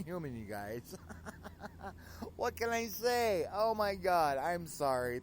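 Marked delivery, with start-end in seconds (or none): 1.0-2.3 a high, soft embarrassed giggle. Real, not acted. DJ giggling to listeners after mixing up the bands, high and soft. DJ embarrassed by her on-air mistake. 2.4-5.2 high, loud flustered exclamation; embarrassed outburst. Real, not acted. DJ exclaiming to listeners about her band mix-up, high and loud.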